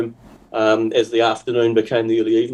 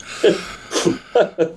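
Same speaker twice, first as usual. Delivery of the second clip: laughing; 7 semitones higher than usual. Real, not acted. Laughs at own toilet story.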